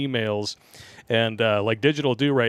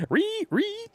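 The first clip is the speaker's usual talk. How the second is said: high mock squeal; 3 dB quieter than usual. Mock squeal after laughing.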